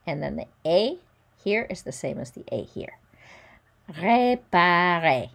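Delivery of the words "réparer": "Réparer" slow and loud as ray-pa-ray, final R silent; "Réparer" drawn out, final R dropped; slow model word. Shown as a demonstration. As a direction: say "Réparer" slowly for the class. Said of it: "we don't pronounce the final R".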